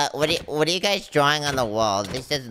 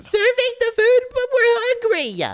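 whiny protest; 5 dB louder, slower than usual. Acted. Protesting guard's order.

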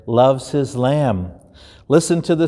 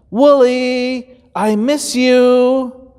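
higher, slow longing call; longing shepherd; 11 semitones higher, much slower than usual. Acted. Shepherd calling to his missing lamb, slow and higher. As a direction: call out slowly, missing him.

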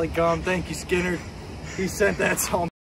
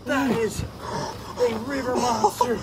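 usual speaking voice

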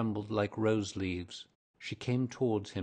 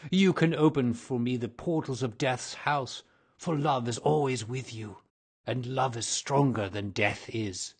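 louder, higher urgent plea; passionate plea; 4 semitones higher, 6 dB louder than usual. Acted. Ghost pleading with Virginia, louder. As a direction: urge her with rising passion.

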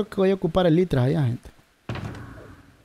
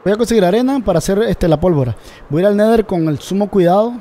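loud excited; 4 semitones higher, 7 dB louder than usual. Real, not acted. Streamer announces plan loudly.

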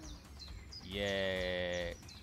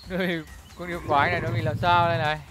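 loud excited commentary; live play-by-play; 6 semitones higher, 15 dB louder than usual. Acted. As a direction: call the monster fight loudly.

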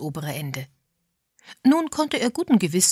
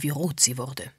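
usual speaking voice

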